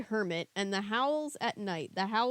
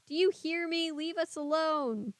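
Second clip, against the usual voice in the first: much higher pleading cry; desperate plea; 7 semitones higher than usual. A narrator's reading. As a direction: beg him in panic. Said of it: "Her pleas".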